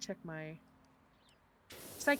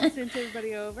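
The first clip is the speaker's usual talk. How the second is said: laughing; 4 semitones higher, 21 dB louder, faster than usual. Retold or imitated. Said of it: "I was like".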